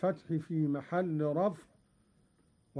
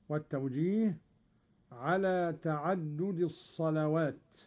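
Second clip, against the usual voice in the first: usual speaking voice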